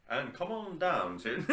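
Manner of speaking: high, slow, laughing announcer voice; commercial announcer. Pretend. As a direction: ham up a cheesy TV ad pitch. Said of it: "trying to sound like a commercial".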